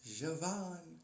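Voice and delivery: "Javan" with a soft zh onset; French-style J in "Javan"; name pronunciation. Shown as a demonstration. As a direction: say "Javan" as zhuh-VAHN. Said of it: "That's how it's pronounced".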